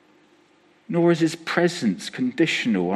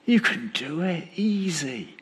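soft, hushed mock comfort, near whisper on marvelous; softer sugary reassurance with an arm round him; mock pep talk; 3 semitones higher, 5 dB quieter than usual. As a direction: coo it like a cosy pep talk. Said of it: "God puts his arm around".

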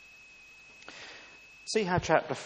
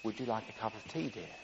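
soft, low, quick question; quiet voice; 5 semitones lower, 9 dB quieter, faster than usual. Acted. Husband at the far end of the kitchen testing his wife's hearing, quiet. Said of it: "asked in a quiet vo-, voice".

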